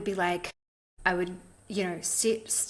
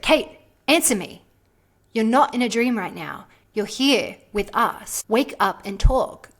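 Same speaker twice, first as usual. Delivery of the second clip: stern demand; 2 semitones higher, 7 dB louder than usual. Retold or imitated. Mentor insisting she answer.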